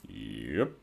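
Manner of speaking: low, soft gloomy reply. A narrator's reading. Big Mac answering the teasing, low and gloomy. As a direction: mutter it down and glum. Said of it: "Big Mac replied gloomily".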